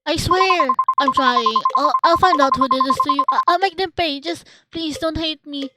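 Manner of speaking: loud, high, tearful begging; desperate crying plea. Acted. Praying fervently to Kazuki for forgiveness, desperate crying plea. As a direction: plead frantically, voice pushed high. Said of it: "listen to your cries".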